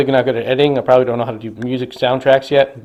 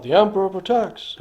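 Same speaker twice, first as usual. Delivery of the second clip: mock group shout; 7 semitones higher than usual. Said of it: "they all scream out".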